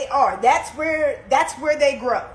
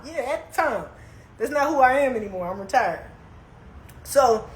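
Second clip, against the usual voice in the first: ordinary delivery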